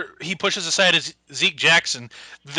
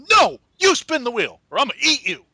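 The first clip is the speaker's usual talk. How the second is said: loud, higher mock-angry yell; 7 semitones higher, 4 dB louder than usual. Mark Henry refusing to spin, mock furious shout. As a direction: bellow the threat at him.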